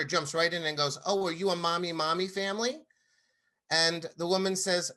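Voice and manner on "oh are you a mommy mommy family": put-on higher, chirpy little-girl question; lightly voiced child, wide-eyed; curious child. Daughter quizzes the single mom, light child voice. Daughter asks stranger again.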